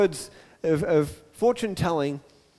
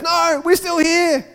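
high, slow, drawn-out call; Paul calling out; 9 semitones higher, 4 dB louder, slower than usual. Acted. Paul stopping the jailer from harming himself, high urgent call. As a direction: stop him with a long raised call. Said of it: "and Paul says".

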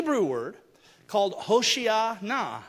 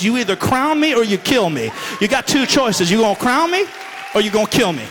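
loud, forceful preaching as Jesus; defiant declaration; 9 dB louder than usual. Retold or imitated. Jesus confronting the Pharisees, loud and defiant. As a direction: proclaim it full force, no holding back.

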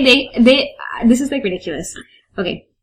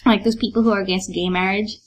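ordinary delivery, nothing marked

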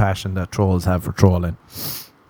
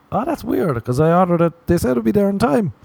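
much higher, mock puzzled; fake surprised son; 11 semitones higher than usual. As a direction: act baffled for mom, pitched way up. Pretend phone call to mom.

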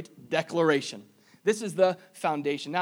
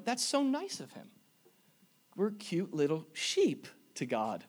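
imagined listener's cooing; 5 dB quieter than usual. Retold or imitated. Preacher imitates congregation finding the shepherd image nice, mock cooing. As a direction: gently imitate a charmed churchgoer, slowing down. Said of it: "And you're going".

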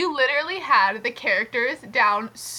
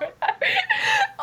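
very high real laugh; helpless laughter. Real, not acted. Friend quotes the story's joke, high laughter. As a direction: break into high-pitched laughing. Friend cracks up quoting a line.

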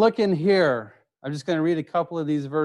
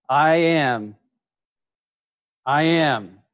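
emphatic repeat; slower than usual. Shown as a demonstration. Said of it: "One more time, for those of you in the back".

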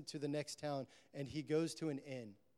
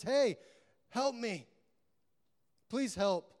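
high, louder plea for help; crying out; 7 semitones higher, 6 dB louder, slower than usual. Retold or imitated. Wounded man calling to the passing priest, crying out. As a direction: call out to a passer-by, acting it. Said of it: "the man cries out and says".

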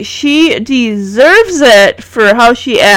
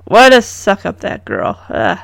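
ordinary delivery

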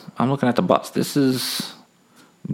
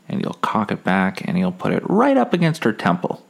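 ordinary talk

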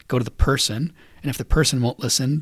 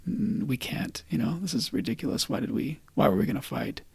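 soft, near-whispered dawning regret; retold second thoughts, trailing into a murmur; hushed realization; 2 semitones higher, 6 dB quieter than usual. Both boys realizing they can't fight, hushed and sheepish.

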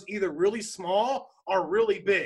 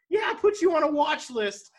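high, loud, cackling gloat; gleeful mock laugh; 9 semitones higher, 4 dB louder than usual. Winston bragging to Nick about the watch list, high laughing gloat. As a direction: laugh then brag, much louder and higher.

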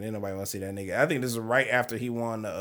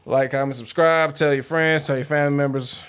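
louder, punchy sign-off plug; podcast outro; 3 semitones higher, 7 dB louder than usual. Acted. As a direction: hype up the subscribe plea. Outro call to subscribe.

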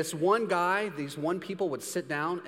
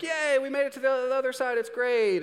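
high, bright mock celebration; mock cheer; 8 semitones higher, 3 dB louder than usual. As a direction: cheer like you just crossed the sea. Israelites cheering after Red Sea.

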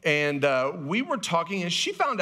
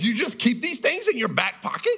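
amused dad question; 9 semitones higher than usual. Retold or imitated. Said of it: "I'm like".

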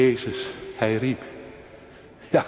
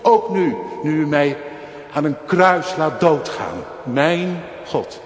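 luid en hoog preken, vol vuur; vurige preek; 4 semitones higher, 5 dB louder than usual. Acted. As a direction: preek het uit met volle overtuiging. Preekt met volle kracht. (vurig preken, luid en hoog)

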